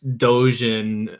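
usual speaking voice

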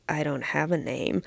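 put-on low hushed creepy child; creepy quiet. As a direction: murmur it eerily low. Creepy boy answer, very quiet.

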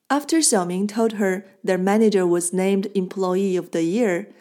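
usual speaking voice

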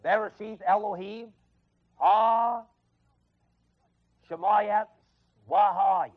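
Genesis one one quoted in halting Hebrew; Hebrew recitation. Shown as a demonstration. Preacher quoting Hebrew from memory to congregation. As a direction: recite "Bereshith Elohim ha Shemayat Wahaya" as remembered Hebrew. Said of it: "I don't even think I can quote it now".

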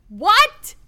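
high, loud incredulous what; a slow, stunned disbelieving what; baffled what. Retold or imitated. Venting to a friend about a dumb movie, incredulous.

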